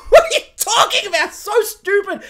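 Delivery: high, loud laughing disbelief; exasperated. Real, not acted. Reviewer mocking the finale, loud incredulous scoff. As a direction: scoff at how stupid it is.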